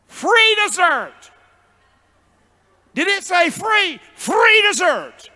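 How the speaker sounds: high, loud, slow "Free dessert"; thrilled shout. Retold or imitated. Preacher acting out his thrill at a free dessert. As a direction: shout it like a kid who just won.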